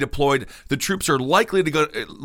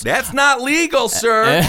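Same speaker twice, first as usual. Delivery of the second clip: a hammed-up, much higher, louder "that's not legal, sir"; mock indignant; 10 semitones higher, 5 dB louder, slower than usual. Host mock-scolds an imagined boss, high and indignant. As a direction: play the outraged employee scolding the boss, tongue in cheek.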